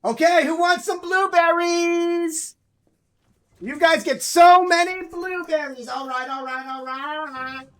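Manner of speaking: high, loud, drawn-out sing-song announcement; excited treat call. Real, not acted. Owner offering blueberries to the pets, excited treat call. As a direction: hype them up, stretching out the words.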